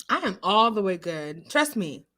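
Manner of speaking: ordinary talk, nothing marked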